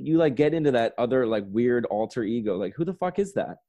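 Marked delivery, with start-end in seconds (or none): none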